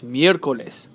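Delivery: miércoles stressed on MIÉR; stress third from last. Shown as a demonstration. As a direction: correct miércoles, first syllable strongest. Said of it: "The stress is on the third to last syllable".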